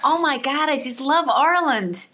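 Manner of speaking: high, swooping mock enthusiasm; imitating a gushing stranger. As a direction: parody a clueless fan of Ireland. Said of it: "my favourite one is".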